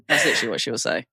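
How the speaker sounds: usual speaking voice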